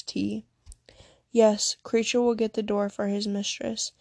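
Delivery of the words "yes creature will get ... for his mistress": ordinary delivery, nothing marked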